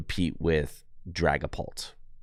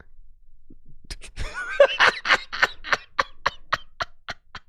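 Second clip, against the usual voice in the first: laughing. Real, not acted. After winning flips.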